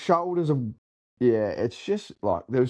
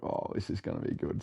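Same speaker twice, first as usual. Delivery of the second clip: hushed aside; 3 semitones lower, 10 dB quieter than usual. Retold or imitated. Retold thought seeing jaws drop.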